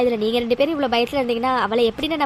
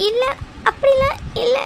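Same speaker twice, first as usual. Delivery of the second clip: high sobbing denial; crying; 9 semitones higher than usual. Acted. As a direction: deny it through tears. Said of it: "இன்னும் அழுதுட்டு இருக்கீங்க". (அழுதுகொண்டே மறுப்பு)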